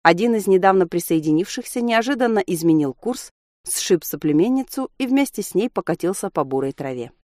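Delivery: ordinary delivery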